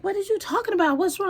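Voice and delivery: high puzzled what's-wrong; lightly voiced confused partner; confused man. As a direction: play him as baffled. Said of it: "So he was like".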